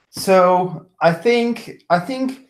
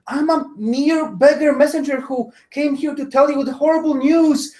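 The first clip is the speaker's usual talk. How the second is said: higher, pleading messenger voice; frightened pleading; 7 semitones higher than usual. Acted. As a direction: beg the troll for your life. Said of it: "but he just, like".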